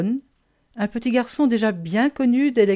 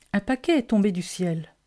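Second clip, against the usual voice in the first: ordinary delivery, nothing marked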